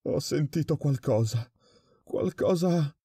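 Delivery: high, breathless frightened stammer. A narrator's reading. Prisoner telling the director what he heard, frightened stammer. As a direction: stammer it out in fear, pitch climbing. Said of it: "balbettò l'altro".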